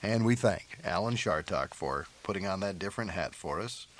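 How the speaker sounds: usual speaking voice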